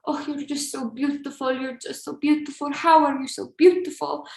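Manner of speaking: put-on gushing compliment in an Albanian accent. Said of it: "I'm just trying to do his cute accent".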